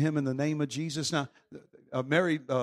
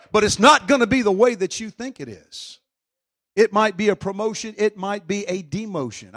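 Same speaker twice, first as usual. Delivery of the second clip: loud, higher preacher's fire; full-force preaching; 4 semitones higher, 9 dB louder than usual. Acted. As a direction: preach it hard to the crowd.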